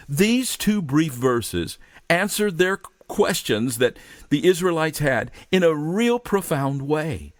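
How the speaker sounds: ordinary talk, nothing marked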